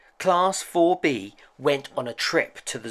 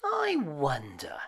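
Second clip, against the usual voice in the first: quiet wondering; 9 dB quieter than usual. A narrator's reading. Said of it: "thought Izzy".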